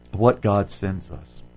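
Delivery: ordinary talk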